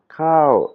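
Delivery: "kao" high and loud, then falling; rising high tone. Shown as a demonstration. Teacher explains the word kao, high tone on it. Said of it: "c'est un son montant aigu".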